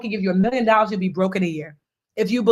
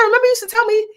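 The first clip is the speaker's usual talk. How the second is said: sister pressing; 13 semitones higher, 5 dB louder, faster than usual. Retold or imitated. Sister repeats her question.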